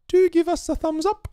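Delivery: tiny high-pitched request for thumbs up. Acted. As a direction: pitch way up to coax the viewers. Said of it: "I did it also in the high voice there for you all".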